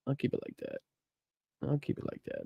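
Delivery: a near-silent whisper, said to himself. Real, not acted. Whispering to himself while fiddling with the screen share. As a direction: breathe I'll keep it like that with no voice.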